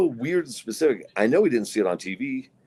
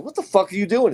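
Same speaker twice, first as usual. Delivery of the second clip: higher, louder mock outburst; indignant yell; 10 semitones higher, 4 dB louder than usual. Four-year-old son shouting at the man sniffing him, mock yell. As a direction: play the kid snapping at the stranger. Son's line retold again.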